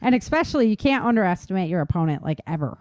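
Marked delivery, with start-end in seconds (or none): none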